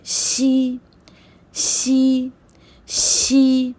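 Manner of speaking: शी with a sheen hiss; pinyin x sound. Shown as a demonstration. Teacher drilling pinyin x, शीन-like शी. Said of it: "एक्स में शीन का sound है".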